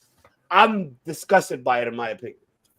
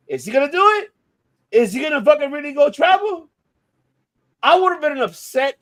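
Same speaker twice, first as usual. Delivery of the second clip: exasperated rant; 9 semitones higher, 6 dB louder than usual. Real, not acted. Rhetorical rant about travel.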